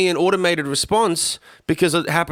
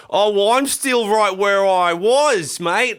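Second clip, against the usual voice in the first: high, slow, drawn-out grumble; exaggerated high-pitched envy; mock envious Aussie; 6 semitones higher, much slower than usual. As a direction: put on a resentful Aussie moan. Mocking envious Aussies looking up.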